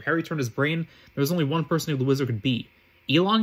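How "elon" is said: mildly testy question, louder and rising. A narrator's reading. Harry asks the masked wizard who he is, testily. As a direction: ask it with a touch of impatience. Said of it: "he asked testily".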